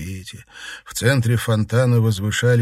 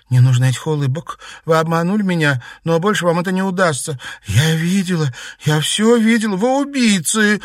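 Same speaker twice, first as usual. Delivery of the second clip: high, loud and building; outraged girl's outcry; exclaiming accusation; 9 semitones higher, 6 dB louder than usual. A narrator's reading. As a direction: accuse them, rising to a cry. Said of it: "воскликнула Наташа".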